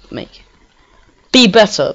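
ordinary delivery

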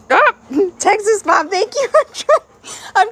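high laughing thanks. Real, not acted. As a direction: giggle through the thanks.

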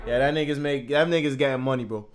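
ordinary delivery

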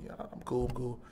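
very soft retold reassurance; subdued shock. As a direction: play it cool, hushed and stunned. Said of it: "I was kinda shocked".

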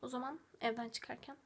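hushed whispered goodbye; whispering. Real, not acted. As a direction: whisper the sign-off. Hushed goodbye before leaving. (fısıltıyla veda)

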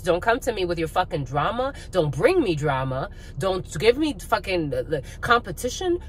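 normal talking voice

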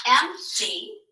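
"M D" as two drawn-out, separate beats; split into syllables. Shown as a demonstration. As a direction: sound out "M D" syllable by syllable. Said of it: "how do we split up those syllables".

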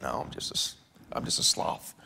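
hushed, embarrassed whisper; a mock ashamed whisper; sheepish whisper. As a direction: admit it under your breath. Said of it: "and you say".

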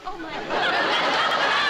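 wife discovering her husband collapsed after dancing, drawn-out alarm; alarmed gasp. Acted. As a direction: stretch it out in shock at the sight.

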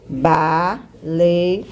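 "Baleia" broken into ba... lei... a with pauses; "Baleia" in three separate beats; syllable by syllable. Shown as a demonstration. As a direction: count out the syllables of "Baleia" slowly. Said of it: "Quantas vezes eu abro a boca".

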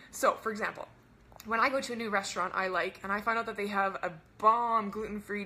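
ordinary talk

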